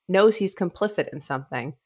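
usual speaking voice

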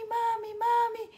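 high, slow, joyful calling of mami; children greeting their tired mother with joy; excited child calling. Retold or imitated. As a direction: call mami like a happy child running to her.

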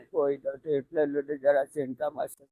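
low, soft words through real tears; crying. Real, not acted. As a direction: admits it quietly, still crying. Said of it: "અમે છીએ હો".

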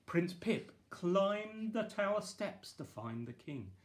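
ordinary delivery

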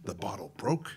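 hushed, toneless confession; whispered aside. Retold or imitated. Admitting the tequila bottle broke, in a hushed whisper. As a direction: breathe it like a guilty secret. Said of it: "whisper (measured)".

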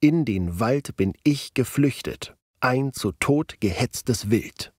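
slow, first syllable of each foot stressed; trochaic stress. Shown as a demonstration. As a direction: recite with a hard trochaic beat. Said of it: "wie in Meyers Gedicht Abendrot im Walde". (langsam mit deutlichen Trochäen vorgetragen)

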